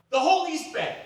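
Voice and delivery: high, loud burst of ambition; excited. Retold or imitated. Escalating his ambition to Bruce.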